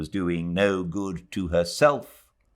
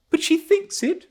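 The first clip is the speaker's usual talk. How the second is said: put-on higher, insistent girl's voice; fiancée insisting to Ronnie; high young woman; 5 dB louder than usual. A narrator's reading. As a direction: presses the point lightly. Adela insists.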